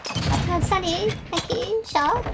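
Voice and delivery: lightly sassy, teasing the eavesdroppers; snarky retort. Retold or imitated. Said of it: "It's like".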